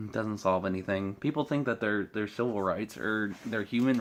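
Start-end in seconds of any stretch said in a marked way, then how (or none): none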